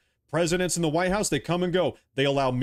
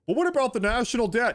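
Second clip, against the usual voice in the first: high, whining mock question; mocking critics who fret about the national debt, high and sneering; mock whiny critic; 9 semitones higher than usual. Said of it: "mocking the critics' question".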